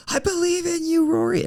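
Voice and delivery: high-pitched, impassioned mock yell. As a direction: imitate a mentor yelling encouragement at full pitch. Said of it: "he started yelling".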